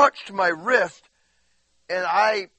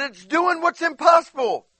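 high, raised voice of exaggerated complaint; mock whining; 9 semitones higher, 6 dB louder than usual. Preacher answers God's question about walking on water, mock whining. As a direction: protest it in an acted whine. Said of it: "I start whining and crying".

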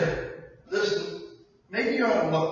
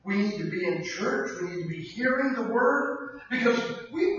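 ordinary talk, nothing marked

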